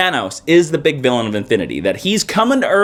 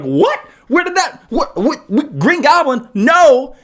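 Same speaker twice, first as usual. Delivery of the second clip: high, stammering mock outrage; confused disbelief; 10 semitones higher, slower than usual. Reader shocked by the twist, high stammering disbelief. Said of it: "and you're like".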